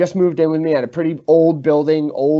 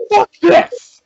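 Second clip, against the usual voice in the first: high, loud imitated yell; fed-up outburst; 9 dB louder than usual. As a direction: explode like a roommate who's had enough. Roommate erupting at last doll.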